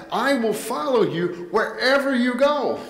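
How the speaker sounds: voiced high, bold vow of a disciple; eager disciple's pledge. Preacher voicing a disciple pledging to Jesus, high and bold. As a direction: lift it like an eager follower promising everything.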